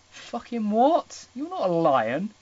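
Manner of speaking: loud, slow disbelieving outburst; stunned at the text; incredulous. Real, not acted. Reader baffled by the book, loud.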